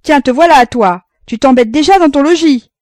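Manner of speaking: exclamation forte et aiguë, jovial; s'écrier en voyant son fils; s'écrie. A narrator's reading. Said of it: "Roland s'écria". (père accueillant son fils, s'écriant fort)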